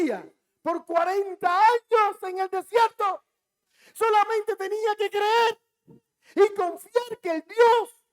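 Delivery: loud, high preaching at full force; preacher thundering about Israel's forty years; fiery preaching. Real, not acted. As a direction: drive it home like a pulpit climax.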